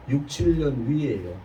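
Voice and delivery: ordinary delivery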